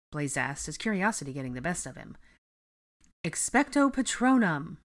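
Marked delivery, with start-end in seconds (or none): 3.2-4.8 loud, slow incantation called out together; chorused spell. Acted. A group shows Blaze a spell, loud slow chant. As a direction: ring out the spell as one voice. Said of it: "A chorus of voices rang together".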